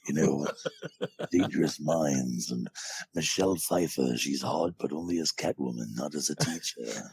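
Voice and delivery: dark villainous movie narrator; dark trailer voice. Shown as a demonstration. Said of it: "that dark, malice-filled ... trailer voice".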